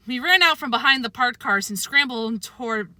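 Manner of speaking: plain reading voice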